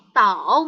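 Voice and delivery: TAO long, dipping then rising back up; drawn-out "tao" with an upturn; full third tone. Shown as a demonstration. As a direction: stretch "tao" through the complete dip. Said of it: "nggak perlu kayak gitu".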